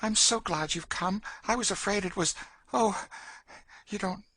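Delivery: put-on high, agitated girl's voice; anxious young Emmeline. Frightened girl confides in older companion, anxious high voice.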